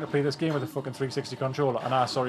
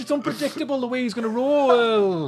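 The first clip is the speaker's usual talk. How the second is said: exasperated; 9 semitones higher, 3 dB louder than usual. Real, not acted. Exasperated complaint.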